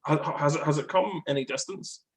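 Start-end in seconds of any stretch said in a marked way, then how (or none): none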